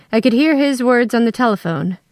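ordinary delivery

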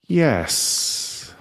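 a light fizzing hiss on the word; fizzy voice. A narrator's reading. The fairy answering with a fizzing, Alka-Seltzer sound. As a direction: let it fizz out like Alka-Seltzer. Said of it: "sounding like Alka-Seltzer".

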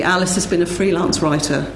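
usual speaking voice

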